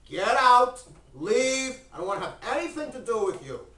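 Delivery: loud, high shout, throwing someone out; acted angry dismissal. Abraham throwing the old man out, angry shout. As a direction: order them out at full volume, then rush on.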